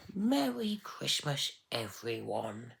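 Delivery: high, slow tiny carrot voice; croaky little voice. Acted. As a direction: croak it out like a tiny sick child. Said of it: "spoke in a croaky voice".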